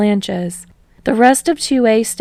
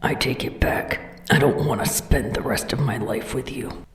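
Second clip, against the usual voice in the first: grumbling whisper; 7 semitones lower, 5 dB quieter than usual. Acted. Bakugo grumbling at boyfriend.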